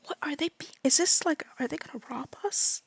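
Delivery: soft unvoiced worried whisper; nervous whisper. Baxter whispering to companions, nervous whisper. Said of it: "He's like".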